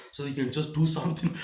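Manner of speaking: ordinary talk, nothing marked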